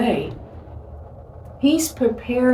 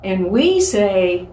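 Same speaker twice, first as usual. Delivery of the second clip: normal talking voice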